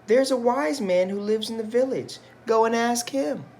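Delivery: high, complaining wife voice; high-pitched fed-up wife; wife's voice. Acted. Wife voiced in read-aloud story.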